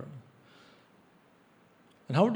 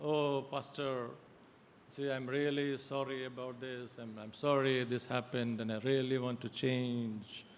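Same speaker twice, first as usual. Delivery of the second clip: softer, pleading penitent; mock penitent plea; 2 semitones lower, 7 dB quieter than usual. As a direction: imitate a churchgoer apologizing to pastor. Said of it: "They come and say".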